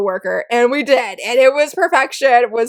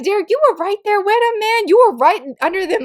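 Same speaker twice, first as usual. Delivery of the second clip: high, fast mock scolding; exasperated scolding; 8 semitones higher than usual. Reviewer scolding a manga character, higher and faster. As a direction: scold him like a friend who should know better.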